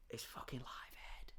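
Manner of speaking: hushed punchline; whispered punchline. Real, not acted. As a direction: drop to a conspiratorial whisper.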